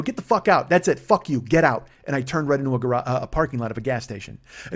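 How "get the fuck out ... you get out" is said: loud, higher furious command; angry order. Retold or imitated. Driver ordering the drunk passenger out, loud and furious. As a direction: throw him out of the car.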